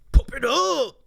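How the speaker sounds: high, loud announcer call; hype announcement. Real, not acted. Announces the redeem.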